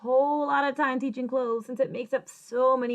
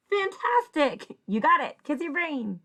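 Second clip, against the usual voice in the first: delighted reveal; 5 semitones higher than usual. Shown as a demonstration. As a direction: celebrate the word as you blend it. Reveals the blended word with praise.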